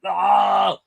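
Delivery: loud high mock scream, shorter; Arnold scream. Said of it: "do the Arnold scream".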